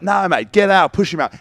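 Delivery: high, loud mock bark from the coach; coach barging in. Coach pushing the young ten out of training, loud and high. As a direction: shove in like a pushy coach. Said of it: "He'd be like".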